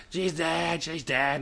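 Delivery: high mock wail of panic; half-hearted imitation of a screaming partner; imitated screaming. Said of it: "she's fucking running around screaming".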